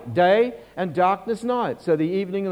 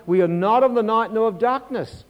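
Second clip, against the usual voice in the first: ordinary talk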